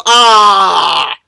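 long loud "ah" of horror; loud drawn-out shriek; scream. Real, not acted. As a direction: scream out in one held note. Screams while reading gory description.

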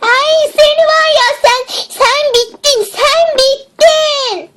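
high, slow, seething threat; furious threat. Acted. Cured girl rages at friend, seething. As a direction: spit out the threat at her. Angry at friend after recovery.

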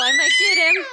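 high squealing cry in play; excited squeal. Pretend. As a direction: shriek it, caught up in the game. Mom squeals in chase game.

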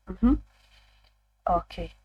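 ordinary talk

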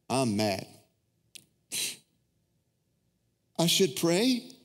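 mildly sulky, reluctant complaint; pouting. Retold or imitated. Pastor re-enacts sulking instead of worshiping, mild pout. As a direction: grumble like a big baby refusing to pray. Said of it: "I was pouting".

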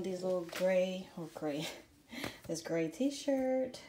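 usual speaking voice

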